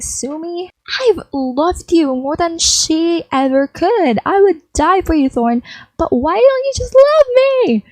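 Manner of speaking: high, rising plea ending in a near cry; desperate pleading. Acted. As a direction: beg him to love you, voice climbing.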